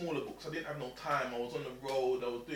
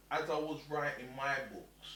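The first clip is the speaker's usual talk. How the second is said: ordinary talk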